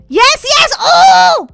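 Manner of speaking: high, loud victory yells; triumphant shout. Real, not acted. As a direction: shout it as you finally make it. Gets farther than ever.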